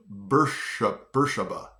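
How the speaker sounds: Beersheba repeated as "BUR-shuh-buh"; actual local pronunciation. Shown as a demonstration. Repeating the local way for viewers, BUR-shuh-buh. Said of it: "But it's actually pronounced".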